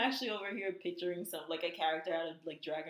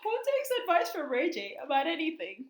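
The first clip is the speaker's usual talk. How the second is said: higher, louder rhetorical questioning; mock incredulous; 7 semitones higher, 5 dB louder than usual. Co-host questioning [name]'s advice, mock incredulous. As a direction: challenge it like it's absurd.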